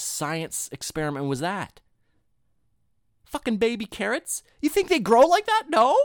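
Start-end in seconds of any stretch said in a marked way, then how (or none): 3.2-6.0 high, loud outraged disbelief; real mock outrage, much higher and louder; incredulous rant. Real, not acted. Ranting at baby carrots.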